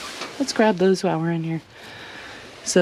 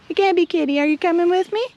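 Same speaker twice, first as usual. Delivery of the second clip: pet talk; 8 semitones higher, faster than usual. Real, not acted. Calling the cat.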